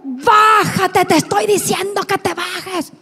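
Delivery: re-enacted harsh shouted order; stretched first word, then the rest tumbling out; imitated angry yell. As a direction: act out an enraged man demanding obedience. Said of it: "Y me dijo".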